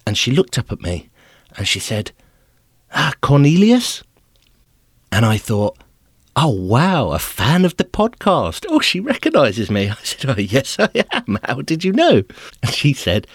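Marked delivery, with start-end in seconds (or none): 2.9-4.0 high, slow, drawn-out call of a name; lightly voiced lady asking a name; a hint of an airport clerk checking a name; questioning call. Lady calls his name. 6.4-9.9 high, slow, delighted mock gasp; chuffed, high mock excitement. Delighted thought, thinks he's recognised. 10.3-12.2 high, eager mock answer; lightly hammed-up pleased reply; beaming, higher eager answer; flattered.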